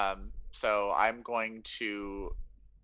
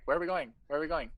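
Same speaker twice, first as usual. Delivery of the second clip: eager follower; 6 semitones higher, faster than usual. Retold or imitated.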